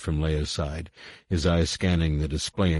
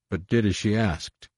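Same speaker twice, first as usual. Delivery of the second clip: ordinary delivery, nothing marked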